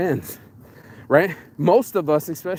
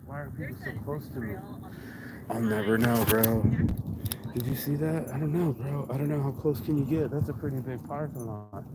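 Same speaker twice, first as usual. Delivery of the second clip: much softer muttering to himself; muttered aside; 2 semitones lower, 9 dB quieter than usual. Real, not acted. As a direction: mutter it under your breath. Muttering about nearby car.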